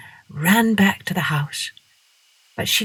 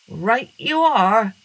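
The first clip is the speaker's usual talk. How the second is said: slow, higher friendly dog; agreeable dog; 4 semitones higher, slower than usual. A narrator's reading. Dog agreeing with Masha, slow and friendly. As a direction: drawl it out agreeably.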